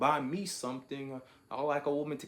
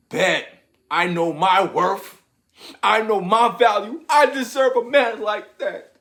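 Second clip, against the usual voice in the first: much higher, much louder hammed-up woman's voice; entitled woman; 9 semitones higher, 13 dB louder than usual. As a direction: ham up a woman bragging about her worth, laughing at the end.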